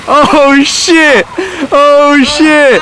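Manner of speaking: loud, high excited yelp; excited shout. Real, not acted. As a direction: cry out in real alarm. Friend jumps into cold water.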